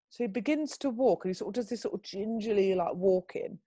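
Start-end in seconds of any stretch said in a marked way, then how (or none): none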